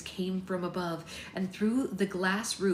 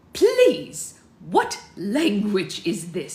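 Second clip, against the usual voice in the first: exasperated Dutch relative; 10 dB louder, much slower than usual. A narrator's reading. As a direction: plead loudly, stretching out the question.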